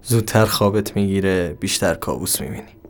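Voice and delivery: usual speaking voice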